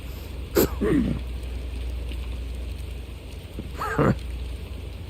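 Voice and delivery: high, breathy villain cackle; mocking laugh. Villain laughs after attack.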